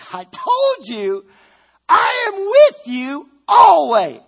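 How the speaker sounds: slow, high preaching shout of the promise. Acted. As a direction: cry it out at full force. Refrain climaxes in a cry.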